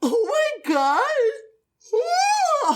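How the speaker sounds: high delighted squeal; excited squeal. Real, not acted. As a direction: squeal with glee. Delighted outburst.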